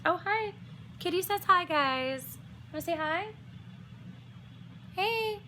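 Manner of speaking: high sing-song voice for the cat; pet-talk voice. Real, not acted. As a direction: coo at the kitty in a high voice. Greeting her cat Millie.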